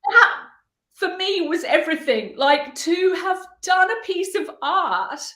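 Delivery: barely holding it together, sobbing the words; crying while talking. Real, not acted. Telling the interviewer what the soldier's words meant, crying openly. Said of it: "people were crying, uh, like I am now".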